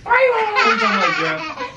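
high giggly squeal through laughter; laughing playful squeal. Real, not acted. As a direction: tease her while cracking up. Dad playfully teasing toddler daughter.

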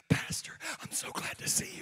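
high breathless whisper; mock starstruck whisper; delighted fan. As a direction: gush like an excited fan. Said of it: "she went".

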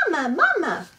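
high, loud little chick cry; peeping gosling. Acted. Newborn gosling calling to the robot, high peeping cry. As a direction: peep it out like a hungry baby bird. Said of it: "peeped the gosling".

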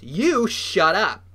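loud, slow, angry snap; snapping. A narrator's reading. Bitten boy silences his friend, snapping angrily. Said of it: "Bull snapped".

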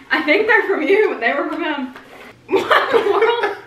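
laughing. Real, not acted. Laughing while telling the story.

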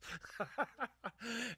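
laugh. Real, not acted. Laughing at own emotions.

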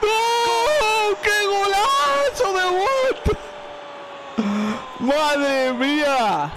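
high, drawn-out goal scream; breakneck goal commentary. Real, not acted. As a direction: roar the goal in disbelief. Reacting to a stunning goal.

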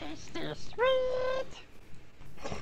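very high, thin, slow creature whisper; very high, slow, soft gollum voice; Smeagol impression. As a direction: creep it out like Smeagol. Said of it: "my Smeagol impression".

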